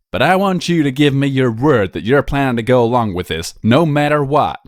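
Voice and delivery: stern aristocrat setting conditions; stern noble. Acted. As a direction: demand his word, firm and pressing. Stern noble lecturing Blue Blood.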